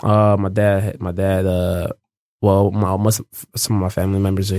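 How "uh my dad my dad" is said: ordinary delivery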